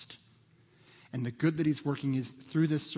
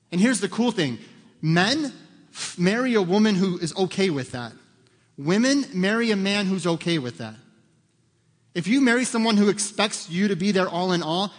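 loud, higher-pitched sermon delivery; emphatic preaching; 6 semitones higher, 7 dB louder than usual. Real, not acted. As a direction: drive each point home to the congregation. Preaches emphatically at raised force.

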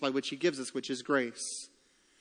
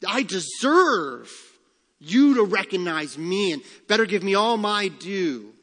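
louder, slow, entitled "I deserve"; demanding; 3 semitones higher, 6 dB louder than usual. Retold or imitated. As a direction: play the self-important person insisting on their due. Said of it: "we demand".

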